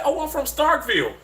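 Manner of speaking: a Southern lady, friendly and curious. Retold or imitated. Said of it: "the lady sound so Southern".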